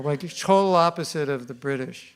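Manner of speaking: ordinary delivery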